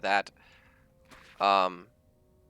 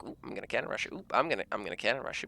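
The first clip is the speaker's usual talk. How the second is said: voiceless, teasing whisper, fast at the end; sneaky whisper; 3 semitones lower, 11 dB quieter, faster than usual. Retold or imitated. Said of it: "being like".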